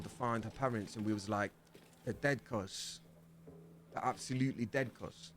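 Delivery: put-on soft London roadman accent; lightly voiced London slang. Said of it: "I can't do that accent".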